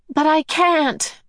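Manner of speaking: high, slow anguished cry; a boy's helpless plea. A narrator's reading. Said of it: "cried in an anguished voice".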